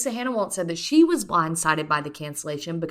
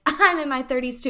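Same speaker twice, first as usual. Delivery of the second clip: mock claim; 7 semitones higher than usual. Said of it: "I said it like".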